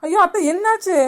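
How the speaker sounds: high, loud cry of alarm. Acted. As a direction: call out in sudden worry. Sees mother-in-law choking. (பதற்றத்தில் அலறும் குரல்)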